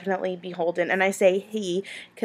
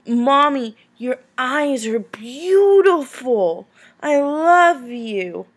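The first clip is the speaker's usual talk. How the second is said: put-on slow, higher, louder little-boy voice; sweet toddler voice; 5 semitones higher, 6 dB louder, much slower than usual. Mom retelling her son's compliment in a slow sweet child voice. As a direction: say it like a tender toddler holding your face. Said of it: "and he goes".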